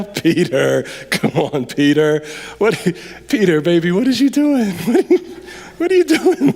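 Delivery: teasing Peter through laughter; half-laughing, can't believe what he's asking; laughing disbelief. Pretend. Preacher teasing Peter on the boat, laughing disbelief.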